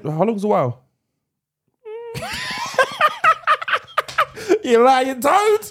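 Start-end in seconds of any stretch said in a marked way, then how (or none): 1.9-4.6 high burst of shrieking laughter. Real, not acted. Catches co-host lying. 4.6-5.7 laughing. Real, not acted. Laughing accusation.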